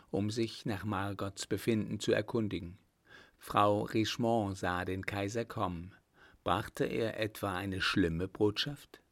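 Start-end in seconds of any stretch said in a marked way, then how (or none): none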